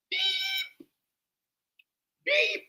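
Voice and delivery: high sung beep; mock censor beep. Streamer censoring a curse word, high mock beep. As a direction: bleep yourself out in a high tone. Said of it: "turned him to a whole beep beep".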